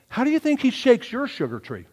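ordinary delivery

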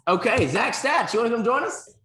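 big showman welcome shout; hype announcer. Acted. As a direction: announce him like a game-show guest. Hyping a new guest in.

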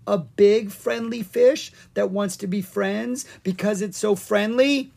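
high, rising hopeful babble. A narrator's reading. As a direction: talk yourself into it, pitch lifted. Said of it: "Owen said hopefully".

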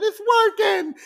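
high, loud cry of triumph; excited cheer. Retold or imitated. The crew sees the fix finally work, excited cheer. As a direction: shout it as a retold breakthrough.

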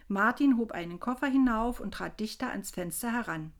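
usual speaking voice

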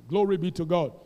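ordinary talk

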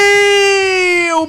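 excited cheer. Real, not acted. Celebrating the launch.

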